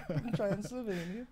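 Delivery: "Transylvania" in a high, laughing mock Swedish accent; half-laughing fake Swedish accent on "Transylvania". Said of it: "That was a Swedish accent".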